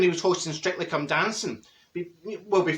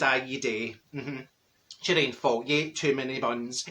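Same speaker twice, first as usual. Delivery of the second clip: tag; 5 semitones lower, 5 dB quieter than usual. Acted. Blunt answer to dress question.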